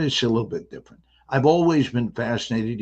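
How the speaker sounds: ordinary talk, nothing marked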